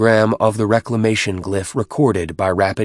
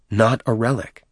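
usual speaking voice

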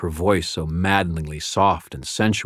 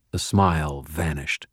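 ordinary delivery, nothing marked

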